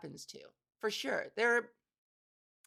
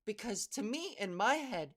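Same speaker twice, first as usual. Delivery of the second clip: ordinary delivery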